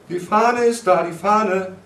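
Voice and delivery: high, loud calling out; urgent, repeated cry; excited announcement. A narrator's reading. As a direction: call the news through the door. Someone calls through door.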